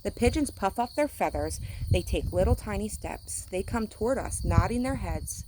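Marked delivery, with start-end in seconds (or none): none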